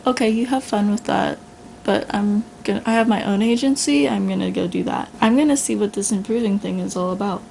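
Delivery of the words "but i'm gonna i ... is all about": usual speaking voice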